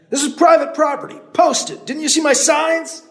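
put-on old man shouting up the trail, loud and high. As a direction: yell it up the hill at him. Landowner shouting at trespasser.

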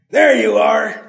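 loud, high; calling. Acted. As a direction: call it out. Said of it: "the man called".

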